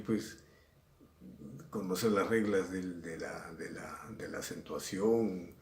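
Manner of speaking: ordinary talk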